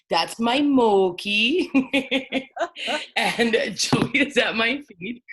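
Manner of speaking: slow, loud, adoring pet talk; doting on dogs. Real, not acted. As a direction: fuss over the puppy slowly.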